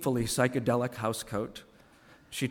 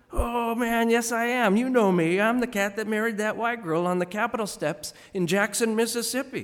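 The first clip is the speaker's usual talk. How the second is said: Sam Jones insisting; 9 semitones higher, 3 dB louder than usual. Acted.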